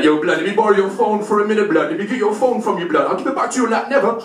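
fast, high, loud youth patter; pushy street youth. Acted. Youth asking to borrow his phone, pushy mock street voice. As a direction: badger him like a street youth. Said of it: "He's like".

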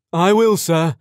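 loud, high polite reply; respectful. A narrator's reading. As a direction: answer the father respectfully. Date answers respectfully.

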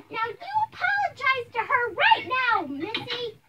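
high, loud scolding order; angry command. Acted. Mother making her child apologize on the phone, angry. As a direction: order it at the top of your temper.

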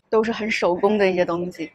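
ordinary talk

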